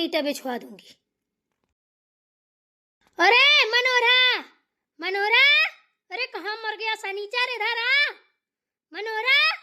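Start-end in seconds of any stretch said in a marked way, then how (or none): 3.2-7.5 high loud drawn-out call across the house. Acted. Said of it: "इतनी तेज तेज क्यों चिल्ला रही हो". 7.5-9.6 high, loud summons with a rising end; calling out loud. Acted. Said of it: "इतनी तेज तेज क्यों चिल्ला रही हो".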